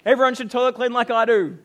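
loud, high mock braggart; boastful show-off. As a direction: crow about yourself, hammed-up. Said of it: "we don't get up and be like".

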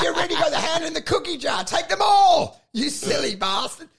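high, fast, laughing tease; laughing mock scolding. Telling the ex-crim mate to take it all. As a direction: egg him on while laughing. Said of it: "I'm like".

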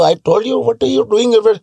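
lightly voiced Indian accent. Comic demonstrating his accent bit to friends, lightly voiced Indian accent. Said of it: "some accents ... Africans ... Indian".

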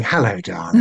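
low, understated charming British villain. Acted. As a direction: menace hidden under smooth courtesy. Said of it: "the bad guys speaking charmingly".

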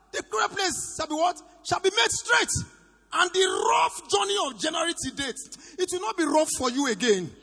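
loud, high-pitched sermon shout; full-force preaching. Real, not acted. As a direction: proclaim it to the whole congregation at full power.

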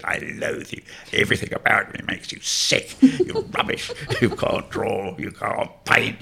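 mock drunken abuse. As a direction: imitate a rude drunk hurling insults. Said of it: "you'd see him in the evening".

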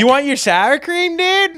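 very high laid-back surfer; surfer dude. Retold or imitated. Surfer dude offering sour cream, very high voice. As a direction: offer it casually, dude-style.

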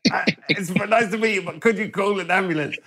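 high, strained politeness; hammed-up feeble plea, pitched high; polite dying man. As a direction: stay courteous while pleading for help. Dying Sam politely asks fans.

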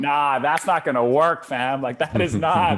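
mock dismissive young man; dismissive guys. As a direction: wave the idea away like a doubtful bro. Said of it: "they're like".

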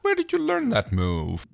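high, slow suspicious pirate. Retold or imitated. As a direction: ask it like a wary pirate. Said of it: "the pirate goes like".

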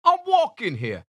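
loud, high New York street shout; hammed-up New York accent yelling at traffic. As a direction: bark it like an angry New York cabbie. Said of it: "What was that accent?".

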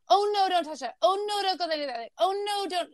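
nagging parent. Retold or imitated. Mother imitating a fussy parent saying no to everything. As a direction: mimic a hovering parent fretting over everything. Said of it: "I'm not the parent saying".

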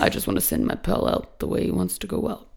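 Johnny Depp. Shown as a demonstration. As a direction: keep it soft and creaky in the throat. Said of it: "now I'm gonna try it".

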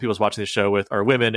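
ordinary talk, nothing marked

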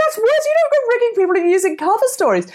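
high, fast indignant telling-off; outraged scolding. Retold or imitated. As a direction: scold him in rising disbelief.

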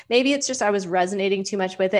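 usual speaking voice

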